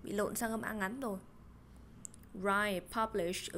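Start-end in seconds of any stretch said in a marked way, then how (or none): 2.4-3.5 slow dictation. Shown as a demonstration. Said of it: "dictation pace".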